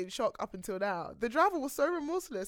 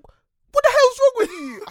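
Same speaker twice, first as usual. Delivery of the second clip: much higher, louder, slower mock yell; angry driver; 14 semitones higher, 14 dB louder, slower than usual. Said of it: "driver's like".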